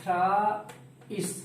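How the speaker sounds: थ्राइस stretched very slowly into parts, "thraa ... is"; teacher breaking थ्राइस into "thraa" and "is"; drawn-out pronunciation. Shown as a demonstration. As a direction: sound out थ्राइस slowly for learners, holding the long aa. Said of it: "इसको पढ़ते हैं".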